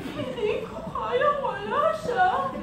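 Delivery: slow, high heartbroken wail; hammed-up sobbing that builds to a loud wail; mock crying. Heartbroken friend moans about moving on, mock crying.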